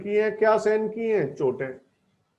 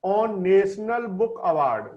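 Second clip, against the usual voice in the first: slow dictation; much slower than usual. Shown as a demonstration. Teacher reading headline slowly.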